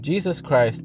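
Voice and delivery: normal talking voice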